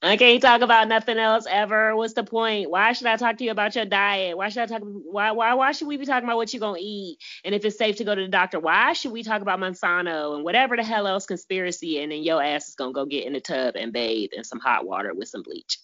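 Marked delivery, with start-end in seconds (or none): 0.0-12.0 mocking rant.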